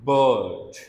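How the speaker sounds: bird with a long vowel and no r; model word. Shown as a demonstration. Teacher says bird so students feel the tongue. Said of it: "so when you say".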